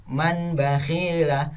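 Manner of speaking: "man bakhila" with a plain short n; wrong unhummed reading. Shown as a demonstration. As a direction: read "man bakhila" without holding the n. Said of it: "bukan seperti itu".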